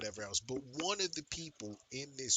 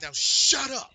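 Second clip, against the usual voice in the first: loud, high outburst; explosive telling-off, acted out; retold angry shout; 12 semitones higher, 11 dB louder, slower than usual. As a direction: shout them down. Retold quarrel, telling them off.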